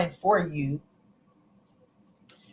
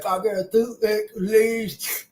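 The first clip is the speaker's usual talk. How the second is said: toothless lisp; 3 semitones higher than usual. Retold or imitated. Repeating how she talks without teeth, a light lisp. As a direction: say it through missing front teeth. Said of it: "I talk like that".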